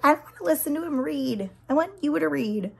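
lightly voiced whiny child; kids complaining. Homeschooled kids refusing sibling's reading, mild whiny imitation. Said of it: "They're like".

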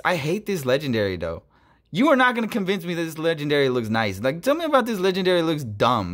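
usual speaking voice

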